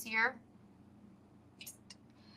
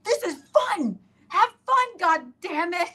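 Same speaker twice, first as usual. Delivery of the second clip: mock yelling; 12 semitones higher, 5 dB louder than usual. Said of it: "I'm like".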